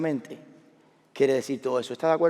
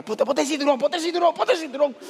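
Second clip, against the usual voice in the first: high, rapid-fire repeated order; acted-out frantic father; panicked urging; 11 semitones higher, 3 dB louder than usual. Father caught by police telling his daughter to buckle up, panicked.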